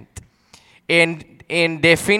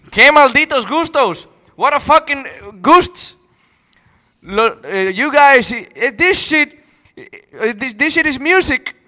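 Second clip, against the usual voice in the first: higher, louder put-on English-speaker voice; mock gringo rant; 7 semitones higher, 3 dB louder than usual. Mocking a commenter's taste in a higher, louder put-on voice. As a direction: play a disgusted tourist.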